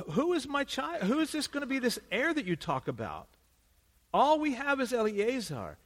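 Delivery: put-on a worried old man begging God for an heir; pleading Abraham.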